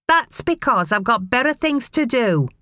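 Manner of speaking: high, sneering mother's voice; scornful mother. A narrator's reading. Mother dismissing her son, scornful and high. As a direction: brush the boy off with scorn.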